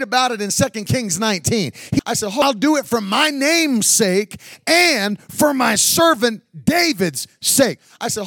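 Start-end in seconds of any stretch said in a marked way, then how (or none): 2.3-7.8 louder, drawn-out repeat of God's words; slow, emphatic quote. Acted. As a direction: lean on every word of the promise.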